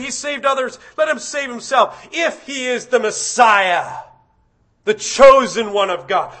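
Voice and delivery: loud, sneering shout; jeering taunt. Retold or imitated. Crowd taunting Jesus on the cross, a loud jeer. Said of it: "they were saying things like".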